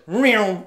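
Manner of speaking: loud mock drive-by noise; imitated passing vehicle. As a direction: mimic a rig roaring past. Mimics passing vehicle as it goes by.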